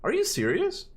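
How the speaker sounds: can't believe what chat just said; incredulous. Real, not acted. Reacting to chat about Primal.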